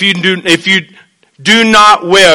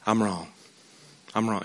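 much softer, lower admission; humble confession; 8 semitones lower, 16 dB quieter than usual. Retold or imitated. Cain admitting fault to God, soft and humbled. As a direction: say it small and broken.

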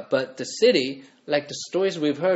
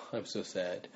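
softer, lower, understated sad voice; mock sadness; 6 semitones lower, 12 dB quieter than usual. Shown as a demonstration. Preacher showing mere sadness, not weeping, mopey and soft. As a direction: say it with a mild, mopey sigh. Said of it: "This isn't like crying, like".

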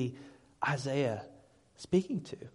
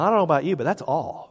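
normal talking voice

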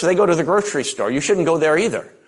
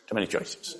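whispered under the breath; 7 semitones lower, 13 dB quieter than usual. Shown as a demonstration. Preacher overwhelmed in the sauce aisle, whispering to himself. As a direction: breathe it out like a defeated aside.

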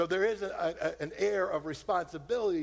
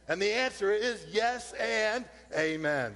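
higher, driving sermon climax; preaching at full force; 4 semitones higher than usual. Acted. Preacher assuring the congregation God cares, rising fervor. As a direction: build the call to the crowd.

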